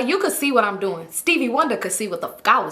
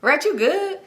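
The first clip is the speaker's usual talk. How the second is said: higher-pitched, amused question; teasing, smile-in-the-voice replay; smiling check-in; 5 semitones higher, slower than usual. Retold or imitated. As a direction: ask it through a smile. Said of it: "I'm over here smiling".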